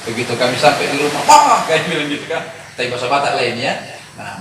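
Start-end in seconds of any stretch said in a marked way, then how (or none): 1.2-1.6 high, loud call for Mah. Retold or imitated. Children calling for their mother as they reach home, high loud call. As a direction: call into the house hoping she's there.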